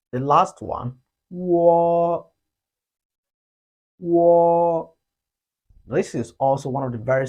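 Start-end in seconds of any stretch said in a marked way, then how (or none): 1.3-4.9 loud, held wo for students to copy; Chinese wo. Shown as a demonstration. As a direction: model wo clearly. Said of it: "read the U sound first".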